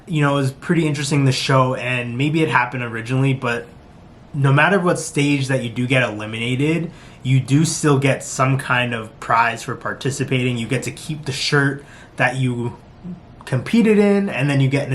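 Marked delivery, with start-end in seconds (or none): none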